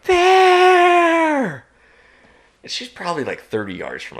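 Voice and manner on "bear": high, loud drawn-out yell of alarm; retold yell. Hiker yells to hunters thirty yards away, drawn-out yell. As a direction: yell it across the distance, one long stretched word. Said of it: "she yells".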